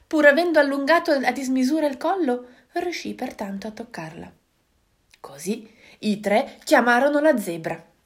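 ordinary delivery

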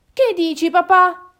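high, loud objection; protesting fox cub. Acted. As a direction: protest to a parent, indignant. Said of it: "protestò Valpacchiotta".

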